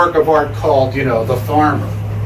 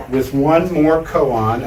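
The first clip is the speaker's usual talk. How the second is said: ordinary delivery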